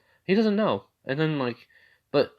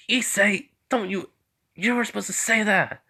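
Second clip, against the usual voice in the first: high-pitched flustered objection; acted protest; 11 semitones higher than usual. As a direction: protest in a much higher voice. Acted protest at tactless remark.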